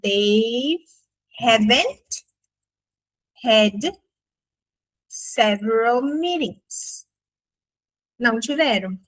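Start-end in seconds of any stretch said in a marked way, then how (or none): 0.0-7.1 "They haven't had several meetings" with long pauses between words; halting teaching demo. Shown as a demonstration. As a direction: lay each word down separately. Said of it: "vamos falar que eles não tiveram".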